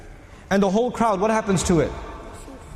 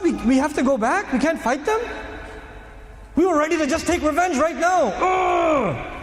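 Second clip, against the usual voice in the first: high, raised protesting voice of the companions; outraged companions; 7 semitones higher than usual. Acted. Companions protesting the treaty in disbelief, outraged. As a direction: voice their outrage, higher and pushing.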